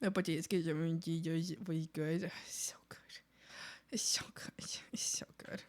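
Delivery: soft unvoiced whisper; hushed whisper of "it's so good". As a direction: breathe it in a secret whisper. Whispering to potatoes.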